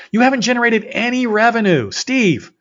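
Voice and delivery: high, loud exasperated yell; freaking out. Retold or imitated. Yelling at his partner about no revenue, frantic. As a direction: shout it at the top of the voice. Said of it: "And I'm literally freaking out".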